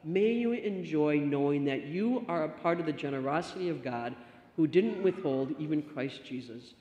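ordinary delivery

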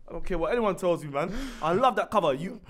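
normal talking voice

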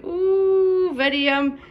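put-on high squeaky baby-talk coo; cooing yum. Owner coaxing cat to eat, high coo. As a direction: sell the food to the cat in a squeal.

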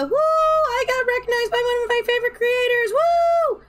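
high, loud, giddy whoop; excited cheering. Retold or imitated. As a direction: cheer like a thrilled fan. Acting out her own cheering.

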